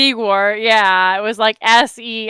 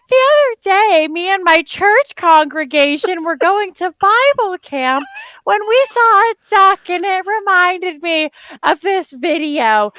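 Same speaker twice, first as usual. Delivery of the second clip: high, louder, exaggerated prim recital; mock wholesome storytelling; 7 semitones higher, 4 dB louder than usual. Mocking clean YouTube talk, church camp anecdote in high prim voice. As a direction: put on an over-innocent church-going storyteller. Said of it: "I have to be on here like".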